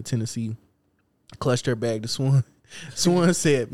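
ordinary talk, nothing marked

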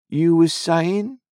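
slow, high, loud sly drawl; sly stranger. A narrator's reading. The stranger questions Joe, slow and sly. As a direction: lean in slowly like a secretive man.

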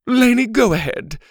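high, loud teacher; put-on teacher giving permission; teacher's voice. A narrator's reading. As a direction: raise pitch and volume as the teacher. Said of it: "Missus Arthur scolded".

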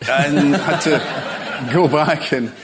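slow words broken up by real laughter; laughing. Real, not acted. Author laughing at forgetting his own dog, real laughter. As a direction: laugh through it, drawn out.

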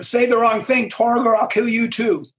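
acted angry warning; fuming threat. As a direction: threaten a subordinate, still fuming. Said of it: "Krug is still fuming. He's angry".

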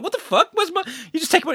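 high-pitched, indignant mimicry; mock outrage. Actor imitating players he robbed, mock outrage. Victims discover they were robbed.

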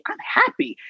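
ordinary talk

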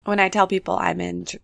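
ordinary talk

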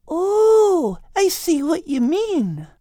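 put-on high, slow curious girl; young girl voice. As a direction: ask it like a puzzled girl. Kate's line.

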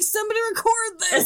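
high, quick, laughing shout-out; mock urgent call. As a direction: pretend to call out urgently, amused. Imagined friends calling out.

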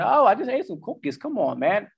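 high, mock-pleading whine; pleading brain voice. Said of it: "Plucks you in the back of the head".

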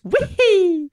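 high, loud falsetto mascot cry; Charles Martinet's Mario. Retold or imitated. Imitating the voice actor with nobody in line, high Mario voice. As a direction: squeal it out like a video game hero. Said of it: "he would only use that voice".

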